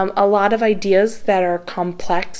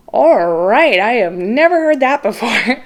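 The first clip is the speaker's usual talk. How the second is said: spirit guide voice; 3 semitones higher, 5 dB louder than usual. Retold or imitated.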